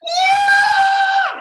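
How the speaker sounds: put-on high, very loud girl's shriek; piercing scream. Storyteller imitating a terrified girl in the car, piercing scream. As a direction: let out a blood-curdling scream. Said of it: "never heard a person scream like that".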